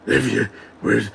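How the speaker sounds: mock tough-guy movie rasp; Adrien Brody. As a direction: imitate an absurdly gruff action-film voice. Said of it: "Adrian Brody doesn't talk like that".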